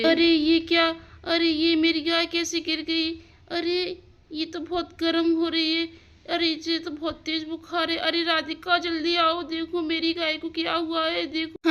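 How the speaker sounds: alarmed aunt. Acted. Aunt calling Radhika to her fallen cow, high and alarmed. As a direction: cry out for help in a high, worried voice.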